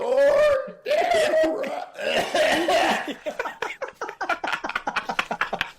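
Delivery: loud, high strained cry; strained screech. Pretend. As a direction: belt a high screech till it strains the neck. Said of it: "Too much".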